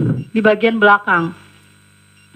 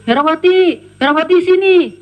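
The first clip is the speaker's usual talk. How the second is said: higher-pitched imitated summons; calling out; 5 semitones higher, 4 dB louder than usual. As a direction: call the maid from downstairs, imitating the boss. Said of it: "saya dipanggil sama Bu Erin".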